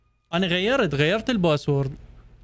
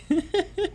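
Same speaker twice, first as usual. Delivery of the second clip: short laugh. Real, not acted. Laughs at own mix-up.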